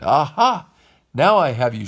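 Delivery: loud, gloating villain shout; terrible shout. Acted. Stromboli traps Geppetto in the trunk, shouting loud and gloating. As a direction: roar it in triumph. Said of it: "shouted Stromboli in a terrible voice".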